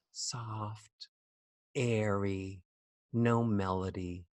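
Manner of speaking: very soft, low, melody-free murmur; soft, airy inside voice. Shown as a demonstration. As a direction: say it softly, as if to yourself. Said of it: "What are the sounds of inside voices".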